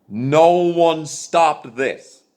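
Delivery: No one stopped this, each word slowly and loudly; slow emphatic. Real, not acted. Ranting at politicians, slow and loud. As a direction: drag it out, hammering every word.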